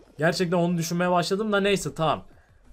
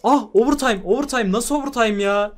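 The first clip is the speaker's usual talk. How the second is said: high, loud last-second yell; excited shout; 6 semitones higher, 6 dB louder than usual. Real, not acted. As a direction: yell it as time runs out. Round ending at last second.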